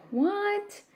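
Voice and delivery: sharp high startled what, kept quiet; mother hearing her son hit a child, asking in disbelief; shocked what. Retold or imitated. Mom told her son punched a boy, a startled what. Hears boy's accusation.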